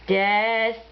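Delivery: usual speaking voice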